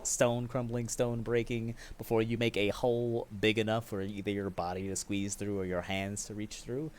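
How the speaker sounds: normal talking voice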